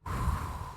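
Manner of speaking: slow breath out; long unvoiced sigh. Real, not acted. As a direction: exhale softly and long. Deep relaxing breath out.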